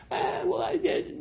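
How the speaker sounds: high, soft, breathless brag; mock a self-satisfied boaster; smug believer. Preacher mocking a believer taking the glory, high and hushed.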